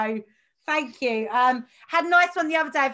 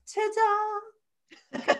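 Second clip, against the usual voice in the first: dog's voice; 8 semitones higher than usual. Pretend. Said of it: "he's like".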